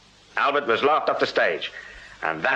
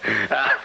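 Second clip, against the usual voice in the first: short amused chuckle; amused laugh; 2 semitones lower than usual. Acted. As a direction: laugh at the constable's verdict. Cribb amused by Thackeray's remark.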